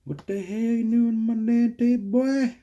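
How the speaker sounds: high, loud hammed-up angry stepdad; angry stepdad impression. Imitating his stepdad scolding him over the table, hammed-up angry yell. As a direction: bark it like a riled-up stepdad. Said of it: "he's gonna be like".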